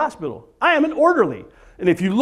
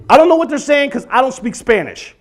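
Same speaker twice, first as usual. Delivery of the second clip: normal talking voice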